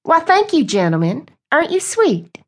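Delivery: high, syrupy sweet-talk; sugary Southern belle. Acted. Reese sweet-talks the men.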